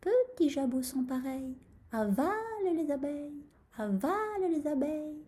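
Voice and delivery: high, monotone little incantation; rooster's muttered spell. A narrator's reading. Rooster tells crop to swallow bees, muttered chant. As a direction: intone it softly like a magic charm. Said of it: "se mit à marmotter".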